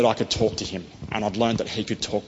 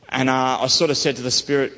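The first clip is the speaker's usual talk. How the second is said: ordinary delivery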